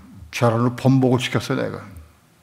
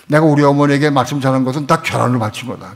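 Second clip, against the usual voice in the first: ordinary talk, nothing marked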